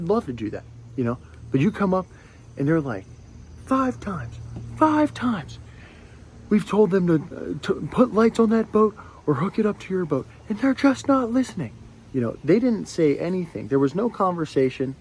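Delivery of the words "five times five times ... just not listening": high-pitched hysterical imitation; mock screaming. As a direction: mimic an irate official screeching, not at full volume. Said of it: "nothing, just screaming".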